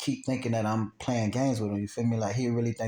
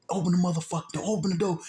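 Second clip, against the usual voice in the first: retold door-pounding demand, higher; heated order, pitched up without shouting; angry demand; 7 semitones higher, faster than usual. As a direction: imitate a gunman ordering a door opened. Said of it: "kicking the door".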